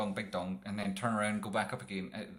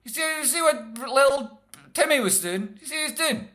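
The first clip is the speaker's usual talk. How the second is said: high, very loud parental yell up the stairs; scolding parent; 17 dB louder than usual. Retold or imitated. Parent yelling upstairs at kids wrecking the stock market, high loud scold. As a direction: bellow like a mum catching the kids at it.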